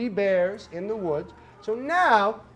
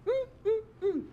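high sassy mm mm mm; sassy mama bear hum; 14 semitones higher, 5 dB quieter than usual. Shown as a demonstration. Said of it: "you'll stand up".

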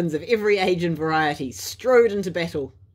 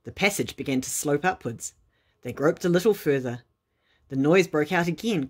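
plain reading voice

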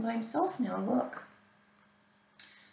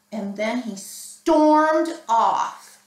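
louder, slow, weighty telling; stormy narration; 8 dB louder, much slower than usual. A narrator's reading. Reader tells children how Jim stormed off, loud and slow. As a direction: stomp the words like his steps.

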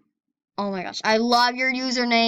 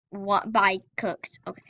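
normal talking voice

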